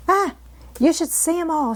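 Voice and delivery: chatty country girl, lightly voiced; Martha's Yorkshire voice. A narrator's reading. Housemaid gossiping to Mary, lightly voiced Yorkshire. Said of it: "she said".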